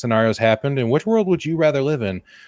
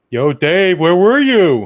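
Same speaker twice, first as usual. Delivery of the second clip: higher, louder, calling out to a friend; possum buddy voice; 5 semitones higher, 6 dB louder, slower than usual. Pretend. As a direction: hail your pal Dave, high and loud. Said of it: "they had a weird conversation".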